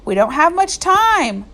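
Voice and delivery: teacher urging the sheriff to act; urgent. A narrator's reading. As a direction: hurry him along. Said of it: "urged Catherine".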